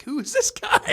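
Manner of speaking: high, loud mock disbelief; hammed-up incredulous outburst; acted alarm. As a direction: act out a startled crowd. Acting out the alarmed group.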